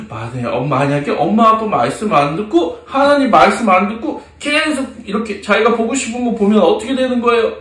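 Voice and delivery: ordinary delivery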